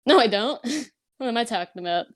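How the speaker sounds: ordinary talk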